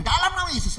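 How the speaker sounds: high, loud invocation; bold declaration. Acted. Girl invoking Jesus' name against her attacker, bold. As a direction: proclaim it with authority.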